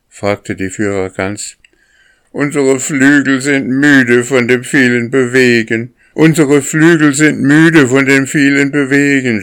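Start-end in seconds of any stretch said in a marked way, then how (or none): 2.3-9.4 high, loud complaining cry; goslings crying out. A narrator's reading. Young geese complaining to the lead goose, high and loud. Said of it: "schrien die Jungen".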